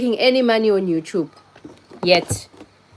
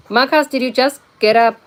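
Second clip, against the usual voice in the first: higher and louder, teasing surprise; playful greeting; 6 semitones higher, 5 dB louder than usual. Real, not acted. Streamer teases viewer Marcus about waking up, raised and playful. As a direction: tease him for sleeping late.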